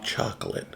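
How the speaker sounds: soft "Chocolate" with an open ah; hushed test of "Chocolate", first vowel ah; other people's pronunciation. Shown as a demonstration. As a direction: try "Chocolate" quietly as "chahk-lit". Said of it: "that's how they say it".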